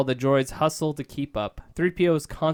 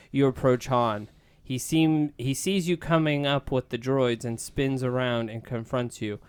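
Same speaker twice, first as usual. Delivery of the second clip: ordinary talk, nothing marked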